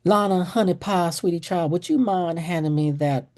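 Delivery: put-on high, syrupy Southern accent; Southern mama asking nicely; sweet Southern request. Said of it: "I call these Southern commands".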